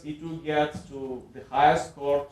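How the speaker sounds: usual speaking voice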